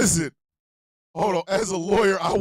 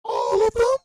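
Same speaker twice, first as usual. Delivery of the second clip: put-on high, eager girlish voice; 13 semitones higher, 4 dB louder than usual. Fan girl answering who she wants, high eager voice. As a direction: pop it up high like a fangirl. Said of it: "And then she goes".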